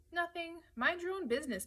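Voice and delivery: high-pitched, defensive retort; testy brush-off. A narrator's reading. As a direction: pitch it up and shut the question down. Jesse brushes Matthew off.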